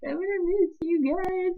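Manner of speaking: high, slow, teary; tearful goodbye. Retold or imitated. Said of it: "and I was just like".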